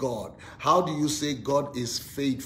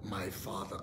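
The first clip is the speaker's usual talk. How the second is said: soft, low spoken declaration; softly leading people in a declaration; confession; 5 semitones lower, 7 dB quieter, slower than usual. Shown as a demonstration. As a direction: speak it softly and lower with the congregation. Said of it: "Say it with me".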